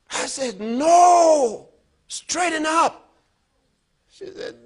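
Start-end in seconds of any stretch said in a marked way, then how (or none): 0.6-3.0 high, loud order to stand straight; loud command. Retold or imitated. Father ordering his daughter to straighten up, loud command. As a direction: command her firmly, loudly.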